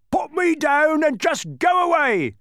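very high, loud panicked cry; terrified giant squealing to be left alone; terrified pleading. A narrator's reading. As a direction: beg in a panic at the top of the voice. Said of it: "he pleaded".

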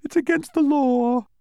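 a creature on Milo's shoe lecturing him; tiny creature. A narrator's reading. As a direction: state the law with sleepy smugness. Sleepy creature citing rules.

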